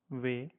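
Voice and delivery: "way" as the German name of W; German W in one syllable; German letter W. Shown as a demonstration. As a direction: say W the German way, "vay". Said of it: "we pronounce it as".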